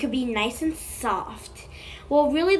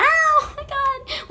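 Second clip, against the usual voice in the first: squealing oh my God; 13 semitones higher, 5 dB louder, slower than usual. Real, not acted. Amazed at slime choices.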